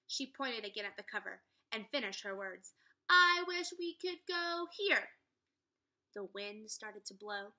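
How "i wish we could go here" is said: slow, high and loud wishing; solemn wish. A narrator's reading. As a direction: savour each word of the wish. Annie makes the wish.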